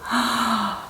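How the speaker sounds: a sudden soft gasp of amazement; sharp gasp. Real, not acted. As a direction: catch your breath at the sight. Opens the bag, amazed.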